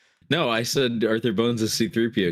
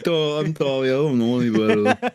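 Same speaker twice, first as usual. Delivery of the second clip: raised, goofy mock voice; slurred dimwit impression; dopey apology; 6 semitones higher, 4 dB louder than usual. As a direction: play dumb, mockingly sorry. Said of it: "And he said".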